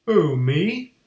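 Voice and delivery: put-on innocent, bewildered boy; puzzled. A narrator's reading. Boy accused on stage, puzzled. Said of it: "looking genuinely puzzled".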